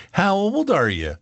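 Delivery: playful high question; parent asking child. Retold or imitated. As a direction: ask it like a parent to a small kid. Retelling a parent asking a kid.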